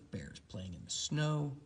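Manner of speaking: usual speaking voice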